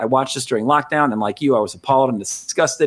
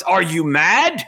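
slow, drawn-out incredulous question; mock all-caps disbelief; 3 semitones higher, much slower than usual. Host reads listener's email protest, mock all-caps disbelief. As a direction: read it out as an all-caps outburst. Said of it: "all caps, exclamation points, and question marks".

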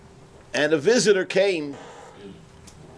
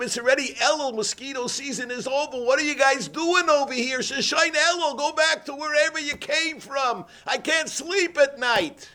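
exasperated shout; 9 semitones higher than usual. Retold or imitated. Man yelling at the mosquitoes to leave, exasperated high shout.

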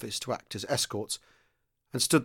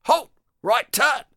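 much higher, loud barked drill commands; parade-ground commands; 14 semitones higher, 7 dB louder, much slower than usual. Acted. Sergeant major marching men into the office, loud barked commands. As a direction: bark each order across the square.

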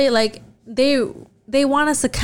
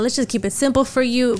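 ordinary delivery

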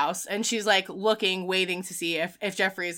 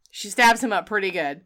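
ordinary talk, nothing marked

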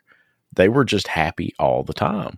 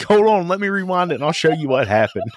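higher, laughing through the words; dad laughing; 10 semitones higher than usual. Retold or imitated. Dad tells the hurt lady to wait, laughing. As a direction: chuckle out the offer like it's all a joke. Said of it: "And he's like".

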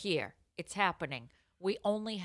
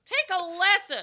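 mock scolding; 11 semitones higher, 7 dB louder than usual.

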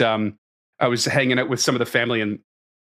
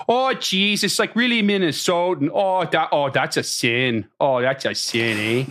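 rural East Coast accent; 4 semitones higher than usual. Shown as a demonstration. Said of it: "rural East Coast is like".

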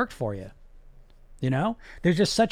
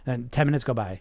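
ordinary delivery

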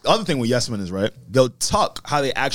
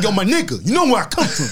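imitating friend's street voice, a touch higher; 5 semitones higher than usual.

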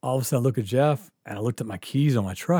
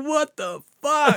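much higher, slow yell of shock; shocked shout; 13 semitones higher, slower than usual. Retold or imitated. Barney sees the auxiliary cop get punched, yelling. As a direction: bellow it as you run in.

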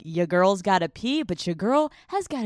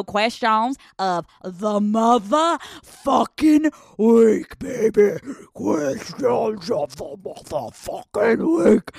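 slow, drawn-out Southern shout, louder; Southern remix shout; 3 semitones higher, 5 dB louder, much slower than usual. Pretend. Host belting her segment title to listeners in a Southern twang. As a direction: belt the segment title like a country hype man. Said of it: "remix questions of the week version".

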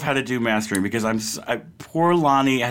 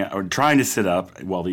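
ordinary delivery

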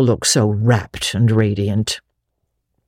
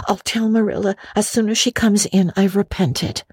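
put-on higher girlish voice, wistful; a lonely girl making the best of it; young Anne; 6 semitones higher than usual. A narrator's reading. Anne's voice.

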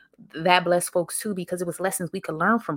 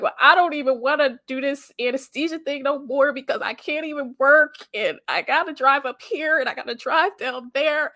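put-on higher, louder teenage complaint; complaining; 6 semitones higher, 3 dB louder than usual. Young nurse complaining to parents about school, whiny. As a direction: grumble about the drive, whiny. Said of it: "listen, whining".

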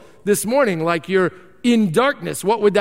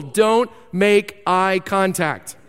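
"Don't make eye contact" spoken slowly, each word separate; slow deliberate rule; much slower than usual. Shown as a demonstration. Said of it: "there's only one rule".

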